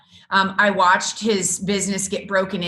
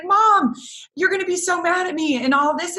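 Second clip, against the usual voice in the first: higher-pitched imitation of a panicking teen; imitated freak-out; 8 semitones higher than usual. Daughter confessing to mom about the gas, imitated freak-out. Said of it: "she was freaking out".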